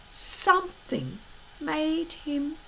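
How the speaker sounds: usual speaking voice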